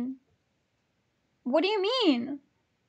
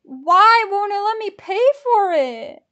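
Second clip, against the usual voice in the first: loud, raised-pitch complaint; exasperated wail; 5 semitones higher, 10 dB louder than usual. Real, not acted. Ticket buyer whose payment keeps failing, exasperated. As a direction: wail it out in real frustration.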